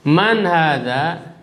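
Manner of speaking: very slow, drawn-out "Man hadza" with a rising end; slow model reading. Shown as a demonstration. Teacher models "Man hadza" very slowly for repetition. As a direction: draw out "Man hadza" word by word. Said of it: "Coba diikuti".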